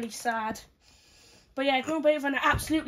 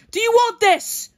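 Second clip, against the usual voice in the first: loud challenge; 7 semitones higher, 8 dB louder than usual. Pretend. Voicing fighter's challenge.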